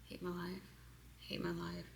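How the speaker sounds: quiet tapping chant fading out; fading whispered mantra. Shown as a demonstration. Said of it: "I'm going fast".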